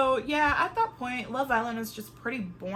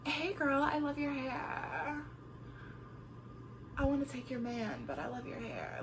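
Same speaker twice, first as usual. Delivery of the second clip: mock fake-friendly, fading softer; sugary two-faced compliment, dropping to a hush; fake sweet; 4 semitones higher, 7 dB quieter than usual. Mimicking fake-nice bombshell.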